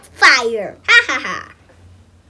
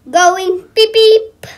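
honking beep beep; 4 semitones higher, 8 dB louder, slower than usual. Pretend. Kid honking as car.

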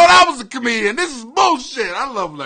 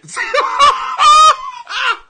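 loud, high-pitched cackle; big squealing burst of laughter; real loud laugh; 6 dB louder than usual. Real, not acted. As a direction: explode into a high, shrieking laugh. Cracks up at Emmitt Smith comment.

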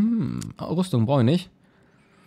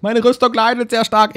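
much higher and louder outburst; excited exclamation; 9 semitones higher, 6 dB louder than usual. Real, not acted. Gamer sees his armour stat drop, exclaiming high and loud.

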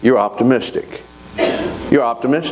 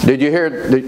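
usual speaking voice

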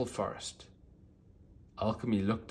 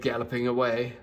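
plain reading voice

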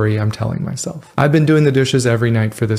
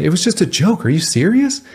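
defensive; 6 semitones higher than usual. Acted. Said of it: "getting defensive and saying".

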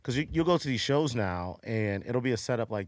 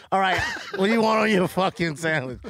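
impatient waitress; 6 semitones higher, 4 dB louder than usual. Retold or imitated. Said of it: "this lady's like".

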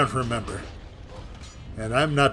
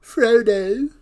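high, loud wail of mock horror; drawn-out, high-pitched fake despair; mock dismay; 8 semitones higher, 8 dB louder, much slower than usual. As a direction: cry out in hammed-up alarm. Mock alarm at game event.

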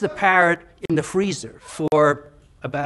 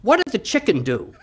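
lightly voiced parrot, raised in pitch; a hint of a parrot, asking in a higher voice; 6 semitones higher than usual. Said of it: "The parrot says".

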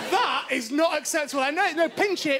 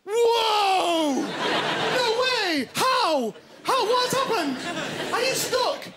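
hammed-up astonishment, high and laughing; mock amazement; 7 semitones higher, much slower than usual. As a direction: overplay the wonder to tease her. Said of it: "me and Ed just went".

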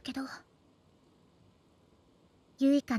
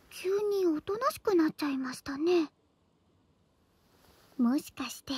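pillow talk. Acted. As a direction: whisper close in his ear. Teasing him in bed.

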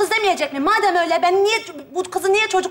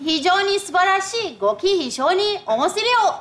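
fake Japanese. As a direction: fake Japanese gibberish in a Turkish accent. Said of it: "Bak şimdi".

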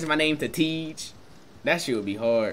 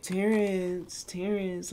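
soft, sweet imitation of girls saying Terrence; a light imitation of the way girls purr his name; 4 semitones higher, 6 dB quieter, much slower than usual. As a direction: coo Terrence like a girl would, a little quieter. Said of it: "Except for when the females say it".

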